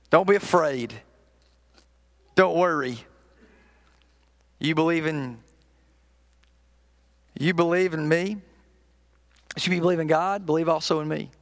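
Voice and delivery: low, hushed comfort with long pauses; quietly consoling, unhurried; gentle reassurance. Acted. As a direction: reassure close friends tenderly. Preacher voices Jesus comforting his friends.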